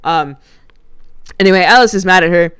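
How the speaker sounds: ordinary talk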